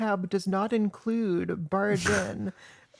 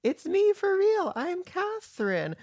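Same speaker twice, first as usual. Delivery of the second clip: mock reveal; 11 semitones higher than usual. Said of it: "goes and is like".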